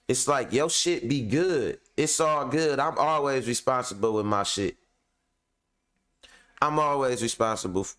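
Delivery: ordinary delivery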